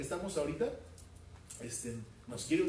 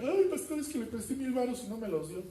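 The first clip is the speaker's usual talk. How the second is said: mock lender whining to the pastor about a loan; whiny complaint; 8 semitones higher than usual. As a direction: complain to the pastor in a high whine.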